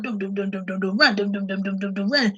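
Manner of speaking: put-on low soft dum dum of kids running; thudding footsteps. Describing neighbor kids running, low mock thumps. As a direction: pat out little running feet.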